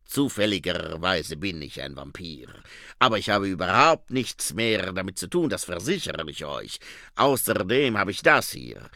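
put-on low, accented vampire voice; Otto Schreck. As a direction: earnestly assure them as a vampire. (Vampirstimme mit Akzent, beteuernd)

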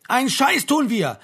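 loud, high, drawn-out angry yell; explosive bellow from an enraged officer. A narrator's reading. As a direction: roar it out in outrage. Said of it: "brüllte der Major".

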